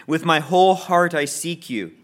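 normal talking voice

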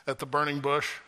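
normal talking voice

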